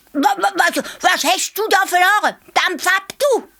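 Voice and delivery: high stammering bossy hare; hare bellowing. A narrator's reading. Hare bellows at the young elephant, high and stammering. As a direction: bluster like a tiny tyrant. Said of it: "Er brüllt den kleinen Elefant an".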